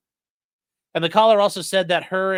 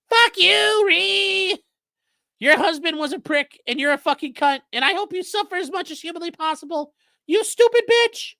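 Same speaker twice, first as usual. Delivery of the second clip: much higher and louder mock falsetto; castrato voice; 13 semitones higher, 3 dB louder than usual. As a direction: squeal the caller's insults high. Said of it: "doing the fucking castrati thing".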